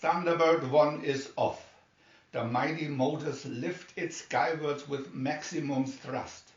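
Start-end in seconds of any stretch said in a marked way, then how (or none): none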